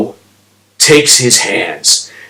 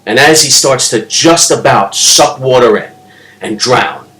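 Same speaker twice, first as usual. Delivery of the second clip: usual speaking voice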